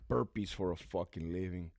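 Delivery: usual speaking voice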